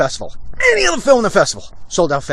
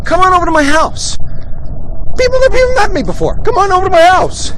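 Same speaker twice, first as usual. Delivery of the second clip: high, loud eager invite; much higher, louder cheerful imitation; 5 dB louder than usual. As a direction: play the overeager host calling you over. Imitating [name]'s invite.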